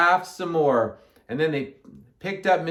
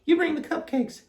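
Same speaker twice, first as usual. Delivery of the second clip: high-pitched character voice; Amelia Bedelia; 12 semitones higher than usual. A narrator's reading. Amelia Bedelia to Alkaloo, high character voice. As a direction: say it up high at normal volume. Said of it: "said Amelia Bedelia".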